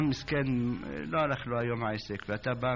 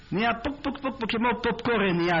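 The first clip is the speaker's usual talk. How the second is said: popping sounds; 8 semitones higher, faster than usual. Acted. Miracle of popping wheat.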